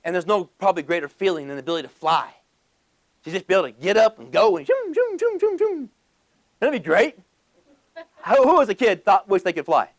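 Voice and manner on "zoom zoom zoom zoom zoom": high, playful flying zooms; zooming noise. Acted. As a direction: zip through the air with high zooms. Mimics flying around as kid.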